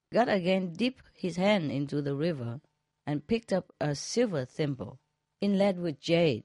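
usual speaking voice

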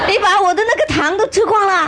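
a startled wail about the eaten candy; hammed-up outrage at the empty jar; shocked master. Master discovers candy gone.

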